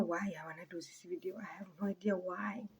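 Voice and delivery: soft unvoiced whisper; whispered aside. Real, not acted. To the camera, wondering aloud in a whisper. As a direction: breathe it to yourself, half puzzled.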